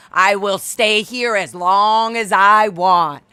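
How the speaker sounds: loud, slow and stubborn; slow loud defiance toward the owner; defiant loud. Retold or imitated. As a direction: plant your feet and declare it. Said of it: "I kept being like".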